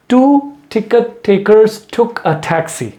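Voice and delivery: very slow, T sounds punched out; slow tongue twister. Shown as a demonstration. Teacher slowly models a tongue twister for practice. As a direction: drag each word, hit every T. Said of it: "मैं एक बार last पढ़ देता हूँ".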